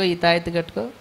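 usual speaking voice

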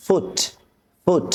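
"ফুট" with a short u; short vowel. Shown as a demonstration. Teacher modelling short vowels for learners, short "ফুট". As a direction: keep the vowel of "ফুট" brief. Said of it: "আমরা টান দেব না".

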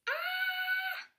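very high, sustained frog scream. A narrator's reading. Voicing the frightened frog in a story, high mock scream. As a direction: let out a thin, high "aah". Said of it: "The frog screams".

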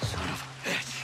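whispered curse; muttered curse. Acted. Graves cursing quietly to himself. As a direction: hiss it under the breath.

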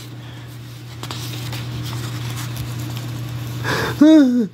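long drawn-out gasp rising high; hammed-up shocked gasp. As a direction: suck in air slowly, then voice it high. Reacting while acting out story.

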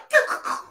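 hushed voiceless snicker; imitated chuckle. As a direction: imitate someone secretly chuckling in the background. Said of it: "I hear, like".

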